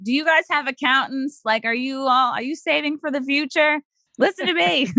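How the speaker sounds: lightly hammed-up concerned auntie pleading with the dancers; preachy money advice. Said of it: "be like".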